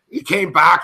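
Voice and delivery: usual speaking voice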